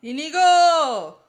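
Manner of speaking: loud, slow call of the name. Pretend. Mock calling out for Inigo. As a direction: call the name across the room.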